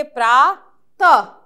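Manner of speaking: प्रा त split into two loud syllables. Shown as a demonstration. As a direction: sound out प्रा then त loudly. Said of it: "तो क्या पढ़ेंगे?".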